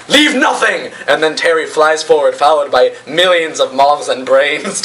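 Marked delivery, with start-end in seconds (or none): 0.1-0.9 high, fierce acted command; battle cry. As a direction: order an all-out attack. Fighter orders total attack.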